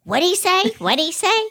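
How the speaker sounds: lightly voiced old lady straining to hear; Myrtle, hard of hearing. Joke teller voicing deaf old Myrtle, high and puzzled. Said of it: "And she goes".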